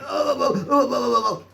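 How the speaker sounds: high, wavering panicked moans; mock frightened wail; imitated panicked moaning. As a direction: moan like you're scared to breathe. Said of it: "and he went".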